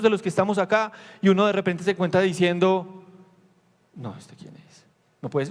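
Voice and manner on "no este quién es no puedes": breathless whispered disbelief; hushed awed whisper; stunned whisper. Retold or imitated. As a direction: whisper it as if in shock.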